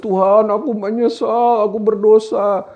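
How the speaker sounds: high, drawn-out mock lament; hammed-up crying prayer; mock tearful wail. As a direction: imitate a weeping penitent. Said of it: "sambil nangis".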